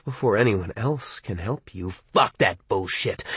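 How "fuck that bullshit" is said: contemptuous. Acted. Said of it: "nearly total rage".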